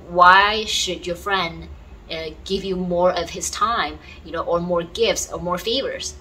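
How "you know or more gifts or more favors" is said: ordinary delivery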